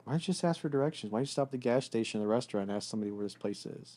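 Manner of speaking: fast, soft nagging; mock nagging wife. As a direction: rattle off her driving advice. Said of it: "you're like".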